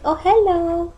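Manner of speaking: put-on high sweet baby hello. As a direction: greet like a tiny baby.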